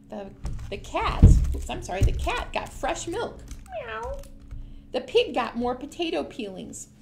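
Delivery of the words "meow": high little meow; cat meow. Acted. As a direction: squeak like a cat. Cat sound in story.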